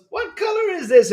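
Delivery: very high, sweet mock cartoon-girl voice; mock Dora. Streamer mocking Dora asking viewers, high and sugary. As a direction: squeak it like a kids' show host. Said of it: "And she goes".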